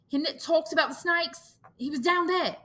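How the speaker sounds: put-on high, anxious boy; frightened. Piggy telling the boys of the missing one, frightened. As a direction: worry pouring out.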